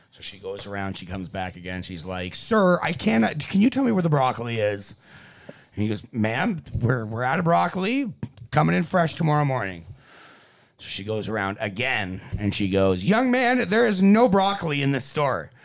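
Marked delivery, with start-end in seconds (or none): 2.3-5.0 higher, louder frustrated shopper; pressing, pitched-up complaint to a clerk; exasperated customer. Acted. 6.1-9.8 higher, louder clerk repeating himself; mildly exasperated store clerk; strained patience. Acted. Clerk losing patience in joke. 13.0-15.5 high, loud scolding shopper; acted indignation, pitched way up; indignant customer. Customer's escalating complaint in joke.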